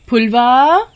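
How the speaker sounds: high, drawn-out searching call. A narrator's reading. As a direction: call through the house for your daughter. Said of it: "उसे बहुत पुकारती है".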